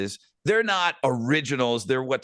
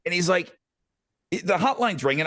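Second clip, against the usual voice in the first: ordinary talk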